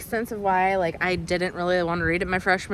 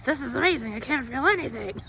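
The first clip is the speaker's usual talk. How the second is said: movie quote; 7 semitones higher than usual. Retold or imitated. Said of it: "Christmas of the Cranks".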